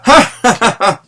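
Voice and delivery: high, put-on "ja, ja"; forced bravado laugh. A narrator's reading. Bruno hiding his reluctance to go, forced laugh. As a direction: laugh off the dare, pretending to be brave. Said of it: "obviamente no quería ir, dijo enseguida".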